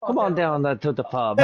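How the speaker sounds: Irish pub invitation, laughing through it; cheery Irish brogue; Irish accent. Acted. Friends riffing, inviting to the pub in an Irish accent.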